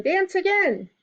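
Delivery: high-pitched eager demand; children's excited cry. A narrator's reading. Children demand another dance from turtle, excited cry. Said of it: "cried the children".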